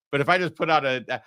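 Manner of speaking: usual speaking voice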